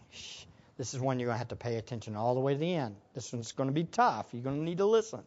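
very soft, low hushed aside; parental shushing. Acted. Preacher plays a parent shushing kids in church, hushed. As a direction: hush the child and warn them quietly. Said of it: "parents that have your kids right there".

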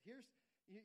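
ordinary delivery

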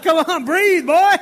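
loud, high mock parental yell; urgent acted command; exasperated parent. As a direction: shout at a sobbing child, hammed up. Acted parent urging sobbing child.

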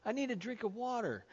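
small, soft imitated child voice; child asking Daddy. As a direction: plead lightly like a little kid. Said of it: "When your child comes to you".

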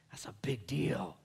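slow, hushed whisper. Real, not acted. Preacher stressing Jesus' identity, whispering. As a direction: drop to a whisper for weight.

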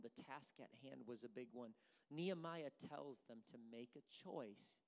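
normal talking voice

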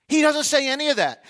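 normal talking voice

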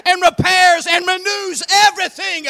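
impassioned preaching. Acted. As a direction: build force with every verb in the list. Preaching surges again.

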